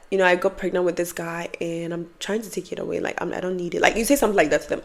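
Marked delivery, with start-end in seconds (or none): 0.1-3.8 soft, lowered voice; hushed, hesitant pretend confession; confiding a secret. Sister confiding a pregnancy to her sibling, quietly.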